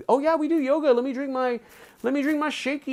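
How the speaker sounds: high, airheaded mock yogi; mocking high-pitched yoga-goer; LA yoga type. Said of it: "especially outside here in L A".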